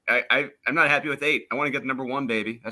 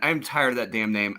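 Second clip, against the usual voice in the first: usual speaking voice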